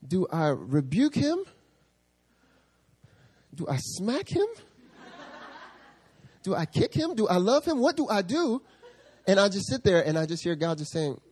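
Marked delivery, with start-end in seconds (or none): 0.1-8.6 climbing much higher with each question; panicked inner thoughts. Retold or imitated. Pastor torn over how to respond, rising higher. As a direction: spiral into comic panic. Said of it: "I'm thinking".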